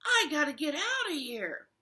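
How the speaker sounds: mild mock panic; panicked wolf. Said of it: "the wolf thought".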